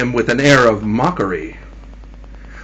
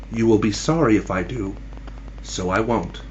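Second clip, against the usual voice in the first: very soft, low wifely reply; quiet reply; 5 semitones lower, 9 dB quieter than usual. A narrator's reading. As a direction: drop to a quiet, low answer. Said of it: "was his wife's quiet".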